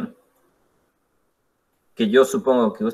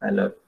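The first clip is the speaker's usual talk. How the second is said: I LOVE YOU low and soft, listless; without enthusiasm; 6 semitones lower, 6 dB quieter, faster than usual. Shown as a demonstration. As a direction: murmur I LOVE YOU on a level pitch, uninterested. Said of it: "¿Cómo dicen sin ganas?". (I LOVE YOU bajito y sin ganas)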